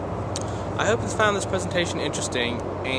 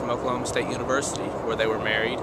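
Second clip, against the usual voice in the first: rushed; much faster than usual. Shown as a demonstration. Rushed retake.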